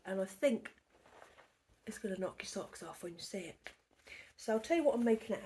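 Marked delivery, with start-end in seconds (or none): 1.9-3.7 conspiratorial whisper. Real, not acted. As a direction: whisper it like a secret. Teasing whisper.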